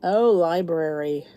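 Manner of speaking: normal talking voice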